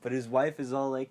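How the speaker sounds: ordinary talk, nothing marked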